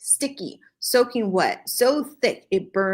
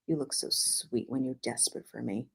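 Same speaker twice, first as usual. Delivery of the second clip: much softer and lower, quick; whisper; 7 semitones lower, 12 dB quieter, faster than usual. A narrator's reading. The hero whispering low to her. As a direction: breathe it low into her ear. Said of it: "he whispered".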